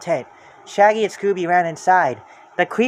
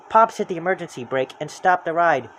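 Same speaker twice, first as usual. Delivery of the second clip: usual speaking voice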